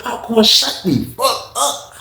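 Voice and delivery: high, slow mocking voice; mock high put-down. Inmate telling Popcorn to shut up, high slow mock. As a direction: stretch it out high to shut him up.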